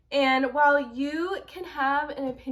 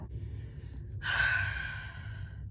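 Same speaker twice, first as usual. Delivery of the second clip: long, breathy sigh; heavy sigh; 8 dB quieter than usual. Real, not acted. Bracing to read bad reviews.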